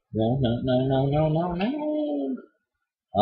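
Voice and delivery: rising, slowing wail of no; exasperated moan; drawn-out no. Real, not acted. Frustrated at failing a jump.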